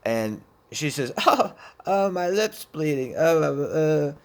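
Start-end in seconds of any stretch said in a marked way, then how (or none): 1.2-4.1 higher, louder imitation of her moaning; mock whining friend. Friend moans to him about bleeding lip, mock whining. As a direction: mimic her dazed "oh, uh" complaint.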